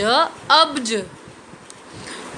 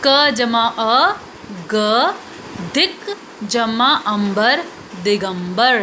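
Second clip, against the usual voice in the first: slow spelled-out sounds. Shown as a demonstration. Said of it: "उच्चारण के साथ समझेंगे".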